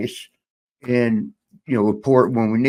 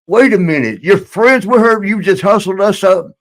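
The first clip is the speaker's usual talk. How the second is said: higher, louder disbelieving shout; indignant outburst; 8 semitones higher, 5 dB louder than usual. Retold or imitated. Wrestlers realizing the host hustled them, indignant shout. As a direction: call out the hustle, outraged.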